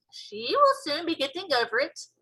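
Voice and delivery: put-on cheerfully convince the girl, slowing at the end; BFG voice. BFG reassuring.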